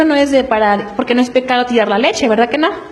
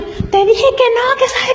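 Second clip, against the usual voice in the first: mock yelling mother; 10 semitones higher than usual. Mother in the supermarket yelling at her toddler, mild mock yell. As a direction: imitate the furious mom, pitch only, no volume. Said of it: "que gritando".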